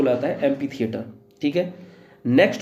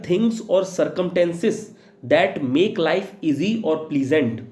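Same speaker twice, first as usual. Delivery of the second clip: each word spaced out slowly for students to write; a teacher reading a definition aloud to be copied down, slowly; slow dictation; much slower than usual. Shown as a demonstration.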